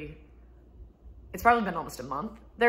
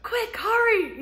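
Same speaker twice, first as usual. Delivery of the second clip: much higher, slow, laughing mock alarm; mock urgent yell; 6 dB louder, much slower than usual. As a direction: parody the kid's frantic call. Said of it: "the reaction of".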